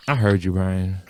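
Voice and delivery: low, soft mom voice; imitated knowing mom. Mom confronting Brian next day, low knowing voice. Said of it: "my mom was like".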